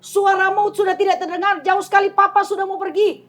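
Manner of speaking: high, loud retelling of father's cry; father's urgent words. Retold or imitated. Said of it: "Dan dia katakan".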